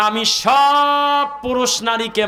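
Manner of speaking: সব held very long on a high note; stretched emphasis on সব. Real, not acted. Preacher translating the verse for listeners, সব stretched long and high. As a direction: draw সব right out, high, then carry on.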